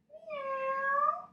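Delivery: high rising kitty meow; cat meow. Shown as a demonstration. Yoga teacher meowing like a cat for the kids. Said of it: "meow like a normal cat".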